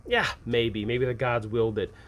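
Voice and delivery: normal talking voice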